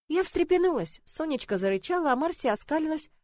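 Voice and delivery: ordinary delivery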